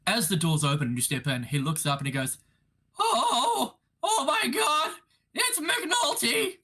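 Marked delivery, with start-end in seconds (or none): 3.0-6.6 very high, squeaky fanboy voice; starstruck timid young man. Acted. Young librarian spotting his detective idol, starstruck and squeaky. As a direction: gasp it out high, drawing out the name. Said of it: "a very timid-looking young man".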